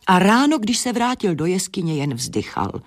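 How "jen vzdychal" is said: plain reading voice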